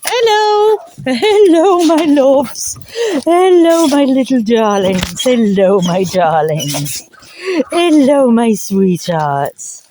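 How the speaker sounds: sing-song hello to the puppies; doting dog-owner voice at the door. Real, not acted. Cooing to her puppies.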